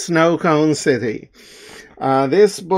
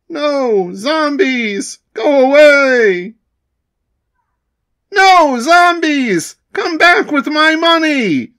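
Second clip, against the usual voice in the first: high, louder panicked screams; panicked cries; 9 semitones higher, 4 dB louder than usual. Acted. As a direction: flee the zombies in a panic. Townsfolk panic at zombies.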